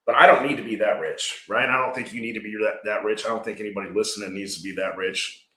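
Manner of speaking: ordinary delivery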